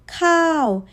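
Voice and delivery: khao high then dropping off; falling tone. Shown as a demonstration. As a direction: fall sharply on khao. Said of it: "Rice falls off the edge".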